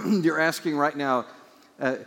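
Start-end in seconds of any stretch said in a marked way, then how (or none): none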